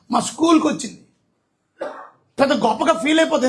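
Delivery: loud, high, driving sermon voice; preaching at full force. Acted. Preacher urging the congregation to be remembered, loud and high. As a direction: preach it out to the crowd, full power.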